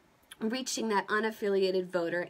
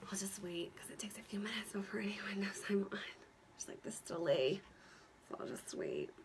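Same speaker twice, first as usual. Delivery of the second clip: whispering; 11 dB quieter than usual. Real, not acted. Whispering while waiting for viewers.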